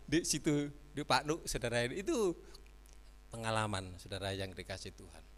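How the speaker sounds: ordinary delivery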